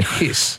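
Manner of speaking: "hiss" with a long snake-like S. Shown as a demonstration. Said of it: "imitate the noise a snake makes".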